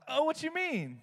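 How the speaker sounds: high-pitched, falsely casual question; hiding awkwardness. Retold or imitated. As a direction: cover discomfort with a squeaky innocent question. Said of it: "I'm literally, I'm just trying to hide my".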